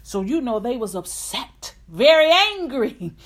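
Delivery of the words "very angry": loud, high preaching; preaching at full force. Acted. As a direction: build the sermon up loud. Preaching climax.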